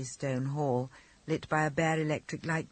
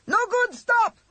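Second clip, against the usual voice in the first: high, loud panicked shriek; 10 dB louder, slower than usual. A narrator's reading. Frightened driver shrieking at the reckless pilot. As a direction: shriek it in terror. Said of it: "shrieked the driver".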